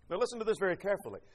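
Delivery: ordinary delivery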